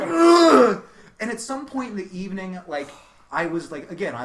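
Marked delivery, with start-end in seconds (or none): 0.0-0.8 disgusted groan. Retold or imitated.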